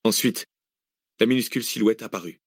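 usual speaking voice

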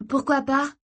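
put-on high girlish retort; defiant girl. A narrator's reading. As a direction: toss it back in a high voice. Girl challenges her brother.

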